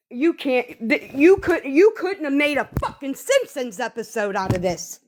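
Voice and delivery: usual speaking voice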